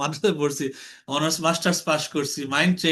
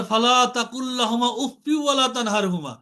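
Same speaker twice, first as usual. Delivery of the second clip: slow, higher chanted recitation of the verse; Quranic recitation; 6 semitones higher than usual. Pretend. Teacher quotes the Quran to his class, slow, higher recitation. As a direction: intone it slowly like a reciter. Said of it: "অথচ আল্লাহ বলে".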